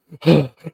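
loud imitated puff of exertion; out-of-breath gasp. Dentist after pulling out the tooth, out-of-breath gasp. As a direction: gasp as if winded from hard work. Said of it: "out of breath".

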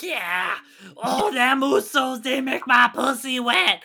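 loud, high, drawn-out raspy heckler impression; hammed-up raspy holler; raspy Newport voice. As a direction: imitate a raspy smoker yelling from the crowd. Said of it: "she had a raspy ass Newport voice".

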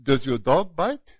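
put-on slow French-accented question, rising at the end; Jacques Clouseau French accent. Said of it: "I'll do my best Jacques Cousteau, uh, accent".

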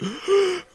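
high startled gasp; imitated gasp. Friend sitting stunned after the skid, high gasp. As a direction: suck in a sharp, high gasp.

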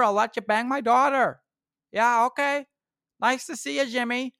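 high, loud hammed-up Upper Midwest "oh yeah" accent; Wisconsin Canadian accent. Mocking how people up in Oshkosh talk, in a hammed-up Wisconsin accent. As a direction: lay on the Wisconsin-Canadian twang like a friendly local. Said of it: "they sure do talk like Canadians up there".

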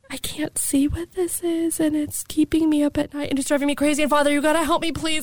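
high, pleading voice on the edge of tears; retold tearful plea. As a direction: imitate desperately begging for help. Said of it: "and I start crying".

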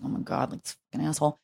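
hushed, seething mutter; a half-whispered furious aside; fuming under breath. Retold or imitated. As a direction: curse him quietly through your teeth. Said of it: "I was fuming".

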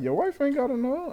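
mocking her. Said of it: "She was like".

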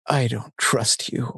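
hammed-up dramatic line reading. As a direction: say it like a ham actor giving the big line. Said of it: "he has to do it, like, more dramatic".

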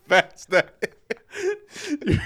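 slow, wheezing laugh through the words; can barely get it out for laughing. Real, not acted. Laughing fit.

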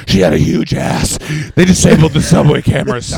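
loud, explosive movie-cop outburst; Al Pacino. Acted. Film star barging in on a robbery, hammed-up shouting. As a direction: blurt it out in a hammy star's shout. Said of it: "Al Pacino would have showed up".

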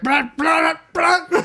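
loud, high mock retching; mock vomiting. Boy sick at his crush's greeting, mock vomiting. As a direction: imitate throwing up in noisy bursts. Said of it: "Fucking throws up all over the floor".

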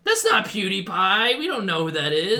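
put-on valley girl voice. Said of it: "girls voice continued".

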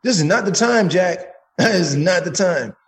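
high, emphatic telling-off; retold angry warning. Man warning off whoever is laughing at him, retold angry warning.

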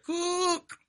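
high, soft quoted movie voice; a lightly voiced character line; movie line quote. Friends quoting Smeed's movie line, high and soft. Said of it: "when Smeed said that".

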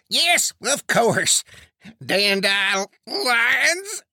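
high, drawn-out laughing agreement; guffawing. Acted. Preacher humouring Turtle Dove about the horses, high drawn-out guffawing. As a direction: stretch the words high, then break into laughter. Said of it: "Preacher guffawed".

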